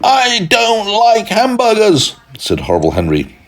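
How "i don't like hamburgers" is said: put-on high, slow, sulky child voice; angry little boy. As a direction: whine it out like a cross little boy. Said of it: "was very angry".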